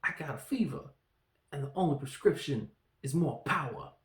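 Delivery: lightly voiced Walken-style sketch line; a hint of the famous cowbell sketch delivery; quoting a comedy sketch in a mild put-on voice; Walken impression. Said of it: "kinda like this".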